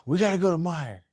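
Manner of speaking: high, exasperated dad voice; urgent dad. Retold or imitated. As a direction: imitate a father announcing trouble. Dad urgently summoning mom.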